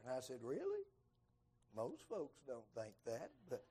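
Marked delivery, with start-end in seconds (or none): 0.4-0.8 high astonished really; surprised. Retold or imitated. Preacher hearing he answered a prayer, high surprised. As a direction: leap up in disbelief.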